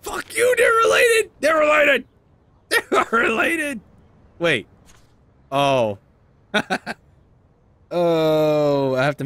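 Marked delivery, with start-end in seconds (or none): 0.0-3.8 high, loud yell of protest; furious shout. Real, not acted. Player yelling at the game character, a high furious protest. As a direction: yell it back at the screen.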